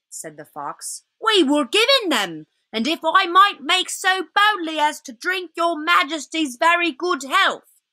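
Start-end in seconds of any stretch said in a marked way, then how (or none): 1.2-7.8 high-pitched, humble fox voice; deferential old fox. Acted. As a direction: placate the queen as a nervous old fox. Fox answers the witch.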